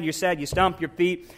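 usual speaking voice

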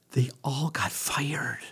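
hushed, slow secret whisper. Real, not acted. Punchline of retold firing story.

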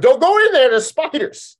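high, loud comic warning; hammed-up cry of danger; mock alarm. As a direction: call out a panicked warning in jest. Mock warning about the spiders.

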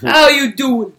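hammed-up Brooklyn catchphrase greeting; cheesy Brooklyn accent. Imitating a New York wrestler, cheesy Brooklyn accent. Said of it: "The, the cheesy Brooklyn accent".

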